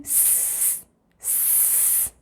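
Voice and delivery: teacher hissing the S sound; bare hissed s. Shown as a demonstration. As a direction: hiss out a long S. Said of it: "teacher isolates letter sound".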